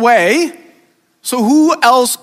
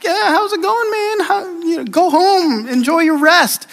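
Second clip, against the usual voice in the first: casual, breezy, higher mock-friendly voice; playing it cool; 7 semitones higher than usual. David acting casual to Uriah, breezy and higher. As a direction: fake buddy small talk, pitched up. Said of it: "David kinda plays it up".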